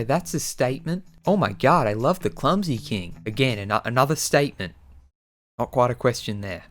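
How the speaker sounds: ordinary delivery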